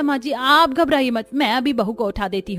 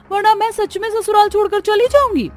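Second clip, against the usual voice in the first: angry threat; 11 semitones higher, 6 dB louder than usual. Acted. Bride threatens to leave home.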